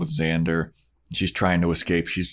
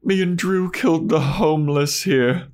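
high, wistful put-on Spike voice; reminiscent Spike; 9 semitones higher than usual. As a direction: sigh it out like a nostalgic vampire. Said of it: "he gets all reminiscent".

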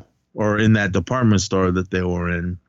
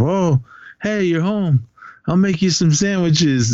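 higher, sweet old granny imitation; mock granny; 7 semitones higher than usual. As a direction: greet the kid like a doting grandma. Grandma greeting the kid.

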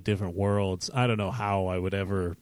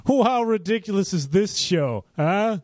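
mocking sarcastic teen; 8 semitones higher than usual. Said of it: "watch the Power Rangers".

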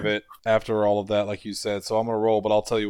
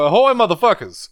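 playful yell; 8 semitones higher, 6 dB louder than usual. Acted. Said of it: "is go".

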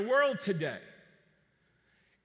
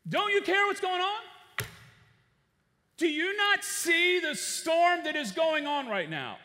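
loud, high, desperate crying out over a storm; panicked plea; 6 semitones higher, 5 dB louder than usual. Acted. Disciples in the sinking boat shouting at sleeping Jesus, panicked. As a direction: plead in panic, loud and pitched up.

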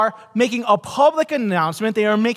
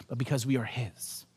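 breathy hushed whisper, much softer; 10 semitones lower, 14 dB quieter than usual. Real, not acted. Preacher closing on God's love, whispered. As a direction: let it fall to a whisper of wonder. Hushed climax of sermon point.

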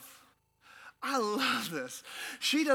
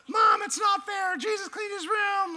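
whiny sibling; 10 semitones higher than usual. Retold or imitated. Kid complaining to mom that Jesus cleaned his room, high and whiny. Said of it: "brothers and sisters".

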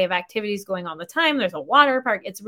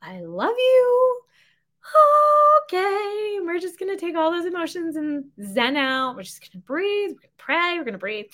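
forced calm; 9 semitones higher than usual. Retold or imitated. Wife to husband on road trip, forced calm. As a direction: talk herself into calm, a little hammed-up. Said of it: "I'm really trying hard to be like".

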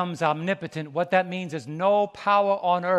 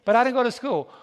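put-on protesting child; 5 semitones higher, 5 dB louder, faster than usual.

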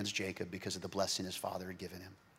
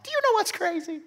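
much higher, eager "Do you know what's crazy?"; excited repeat; 6 dB louder, faster than usual. Acted. Preacher hypes the congregation before the twist. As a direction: spark them with the question.

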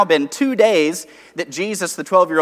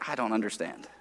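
confused; 8 semitones lower, 14 dB quieter than usual. Retold or imitated. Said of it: "they go".

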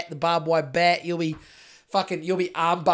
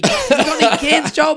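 high, louder pleading voice; whiny begging voice; 8 semitones higher, 7 dB louder than usual. Retold or imitated. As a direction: beg for cans like a hungry stranger.

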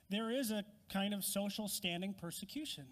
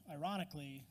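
usual speaking voice

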